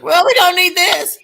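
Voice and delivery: very high, loud mock-carefree voice; Stooge impression. Stooge tossing the broken joystick, imitated very high and loud. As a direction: squeal it out in a put-on voice. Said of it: "He's like".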